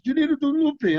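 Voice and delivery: very high, excited French impression; excited French-accented insistence; Jean-Claude. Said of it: "he was all excited".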